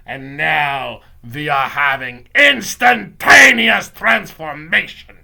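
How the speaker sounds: slow, very loud witch proclamation; triumphant accented witch yell; Grand High Witch yelling. Acted. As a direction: build to a huge gloating shriek, syllable by syllable. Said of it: "the voice of the Grand High Witch yelling".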